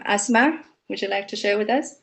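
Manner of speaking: ordinary delivery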